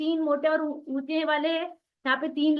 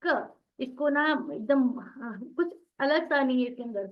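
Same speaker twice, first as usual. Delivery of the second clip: ordinary delivery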